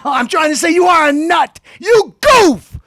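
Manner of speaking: high, loud yelled put-down; a loud, high shouted insult. Acted. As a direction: yell the insult at him. Insulting Bill Maher.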